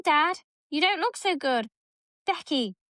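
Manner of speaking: usual speaking voice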